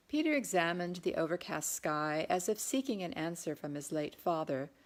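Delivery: ordinary delivery, nothing marked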